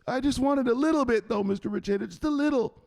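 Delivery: very high, whimpering excuse; pleading underling. Acted. Underling begging Mister Richeda for a little, pleading high. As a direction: beg in a small high voice.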